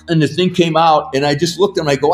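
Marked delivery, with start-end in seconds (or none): none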